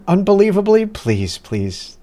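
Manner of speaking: ordinary talk, nothing marked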